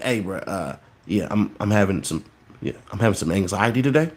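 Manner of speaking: hushed, low, hesitant mumble; mock timid confession. Man imitates someone admitting anxiety to a bro, mock timid and quiet.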